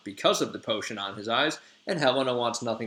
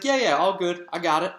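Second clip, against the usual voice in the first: higher, louder, casual reassurance; breezy Puck; 6 semitones higher, 4 dB louder than usual. Retold or imitated. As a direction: toss it off like it's nothing. Puck brushes off the question.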